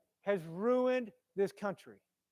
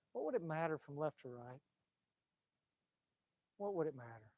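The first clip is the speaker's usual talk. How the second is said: lower, soft wondering question; low musing; 7 semitones lower, 11 dB quieter than usual. Real, not acted. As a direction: ponder it under your breath. Musing aloud, voice drops.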